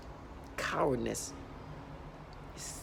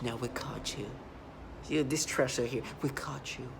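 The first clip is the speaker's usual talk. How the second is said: softer, hushed gloating taunt; 5 dB quieter than usual. Retold or imitated. Voicing the perps who taunt her, quiet gloating.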